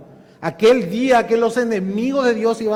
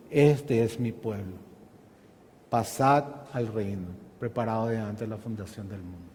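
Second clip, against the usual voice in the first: God to his people, lower and solemn; 8 semitones lower, 7 dB quieter than usual. Acted. God's words to his people.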